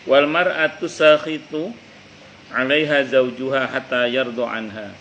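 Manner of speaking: slow, measured Arabic recitation of the hadith. Acted. Teacher recites the hadith to listeners, slow and measured. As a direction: chant the hadith slowly and solemnly, set apart from the talk.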